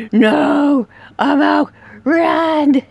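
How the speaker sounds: real gamer panic, fleeing; panicked yelling. Real, not acted. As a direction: yell while running for your life.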